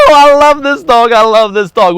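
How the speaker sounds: high, loud gush of love; delighted. Real, not acted. Praising his dog after the jump, high and loud.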